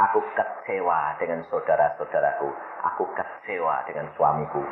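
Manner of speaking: low, soft, subdued confession; istri kecewa. Retold or imitated. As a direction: confess it quietly, low and downcast. Voices the despairing, disappointed wife. (pengakuan lirih istri yang kecewa)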